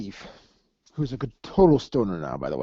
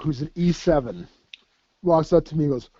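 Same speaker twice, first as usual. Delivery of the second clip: ordinary talk, nothing marked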